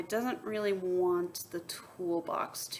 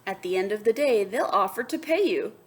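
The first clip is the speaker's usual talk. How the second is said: bright read-aloud to the class; lively storybook voice; story narration; 8 dB louder than usual. A narrator's reading. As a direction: read it like a picture book to kids. Reads picture book aloud.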